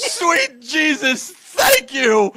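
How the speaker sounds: slow, high, tearful gasp of thanks; overwhelmed cry of relief. Real, not acted. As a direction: sob out the thanks to heaven. Overjoyed reaction to the clip.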